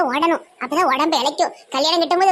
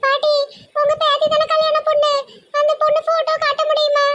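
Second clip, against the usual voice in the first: much higher, fussy feminine squeal; high mock woman's voice; 10 semitones higher than usual. Man playing a girl squeals at the grandma running past, high fussy voice. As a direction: pitch it way up like a silly girl.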